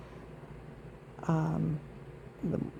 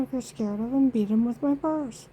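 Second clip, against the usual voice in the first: higher and louder, mocking scorn; scornful retort; 5 semitones higher, 4 dB louder than usual. Woman scolding a frightened man in a parking lot, scornful. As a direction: throw it back at him with contempt. Telling him off, angrily retold.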